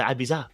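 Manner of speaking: Ibiza said "eye-BEE-za". Shown as a demonstration. Host tells chat to check again, Ibiza as eye-BEE-za. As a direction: use Z, not TH, in Ibiza. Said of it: "You don't say that".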